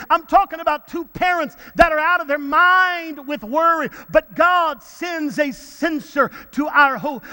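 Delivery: high, driving sermon cadence with stretched key words; full-force preaching. Real, not acted. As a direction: rouse the congregation, punching each phrase.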